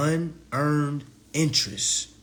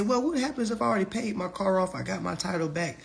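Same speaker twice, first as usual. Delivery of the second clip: lightly voiced higher-pitched questioner; a quicker, higher asking voice; imagined questioner; 8 semitones higher than usual. Student asking teacher about a paid-off car, lightly mimicked questioner.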